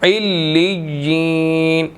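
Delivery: इल्लिय्यीन recited slowly, with the lam and ya run together; slow Quranic recitation. Shown as a demonstration. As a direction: chant इल्लिय्यीन slowly in tajweed style. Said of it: "आइन ने लाम के साथ मिलना है, लाम ने या के साथ मिलना है".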